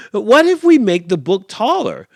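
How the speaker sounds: high, loud excited snake; eager snake. Acted. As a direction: pitch it up, eager for a plan. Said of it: "says the snake".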